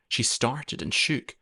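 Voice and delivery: ordinary delivery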